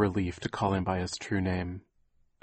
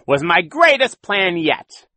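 high, loud, slow villain gloat; Count Olaf growl; 10 semitones higher, 12 dB louder, slower than usual. A narrator's reading. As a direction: relish the evil plan. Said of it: "Count Olaf growled".

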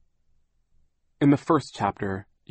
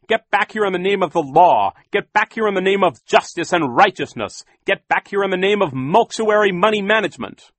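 loud, high, pompous proclamation; shouting; 11 semitones higher, 8 dB louder than usual. A narrator's reading. Banker orders villains back in the law's name, shouting. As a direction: shout grand commands at someone fleeing. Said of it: "Mister Poe shouted".

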